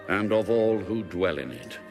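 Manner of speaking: ordinary talk, nothing marked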